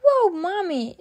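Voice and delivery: high, swooping admiration; amazed child. A narrator's reading. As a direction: marvel like an impressed little girl. Peppa amazed at Mommy.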